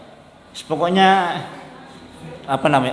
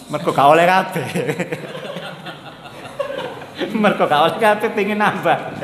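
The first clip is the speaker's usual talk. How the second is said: laughing; 3 semitones higher than usual. Real, not acted. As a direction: laugh as you say it. Joking about wanting another wife.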